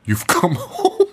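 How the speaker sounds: high, drawn-out line breaking into laughter; laughing mock quote. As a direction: quote it and crack up on the last word. Mocking a film line while cracking up.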